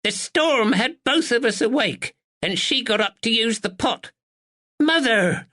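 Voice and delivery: put-on sharp old woman. A narrator's reading. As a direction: snap it out like a sharp sparrow. Old woman, then daughter protesting.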